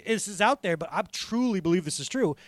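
ordinary talk

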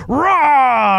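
loud, high imitated car horn blare; angry horn blast. Preacher imitating an angry driver's long horn blast. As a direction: lay on the horn with your voice. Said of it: "different horn beeps".

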